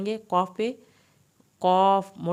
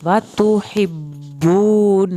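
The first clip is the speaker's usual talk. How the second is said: slow, louder, drawn-out melodic tajweed recitation; Quran recitation; 9 dB louder, much slower than usual. Shown as a demonstration. Teacher reciting the full verse for students, slow melodic recitation. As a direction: chant it out full and slow, stretching the long vowels.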